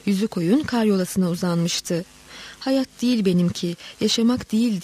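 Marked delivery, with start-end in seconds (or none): none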